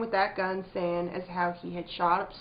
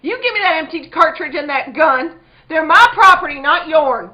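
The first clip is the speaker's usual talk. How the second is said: furious demand; 8 semitones higher, 16 dB louder than usual. Acted. Abby demands gun back fiercely.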